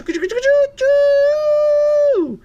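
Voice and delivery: high, loud choo-choo; train whistle. Acted. Thomas setting off past the cow, high loud whistle. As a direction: hoot out like a steam whistle.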